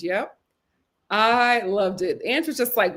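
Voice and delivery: usual speaking voice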